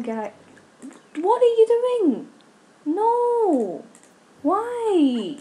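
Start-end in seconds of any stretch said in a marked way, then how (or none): 1.2-5.4 high, drawn-out wail of protest; exasperated dismay. Real, not acted. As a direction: cry out in high-pitched disbelief. Dismayed at game characters' behaviour.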